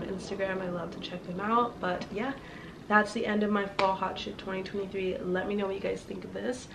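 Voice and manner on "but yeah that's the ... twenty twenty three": normal talking voice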